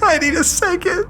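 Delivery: laughing too hard to talk; real breathless laugh; helpless laughter. Real, not acted. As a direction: gasp through real laughter. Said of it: "fighting so hard ... before I lost".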